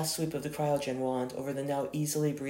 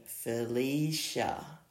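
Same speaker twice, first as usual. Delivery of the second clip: drawled name; much slower than usual. A narrator's reading. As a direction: stretch "Felicia" out very slowly. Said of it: "Emily drawled out the name".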